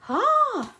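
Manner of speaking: sudden high yelp of surprise; a quick shocked "Ah!"; startled cry. Real, not acted. Startled after the camera mishap.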